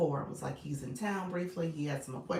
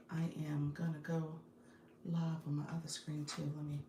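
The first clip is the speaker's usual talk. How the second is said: whispering; 6 dB quieter than usual. Real, not acted. Aside while setting up stream.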